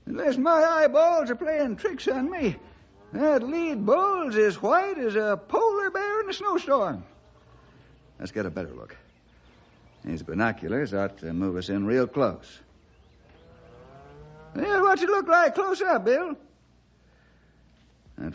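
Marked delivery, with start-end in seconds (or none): 0.1-7.0 put-on high, folksy old-timer voice; old sidekick. 14.5-16.4 put-on high, cranky old-timer voice; grumbling old-timer pressing his partner for answers; old sidekick.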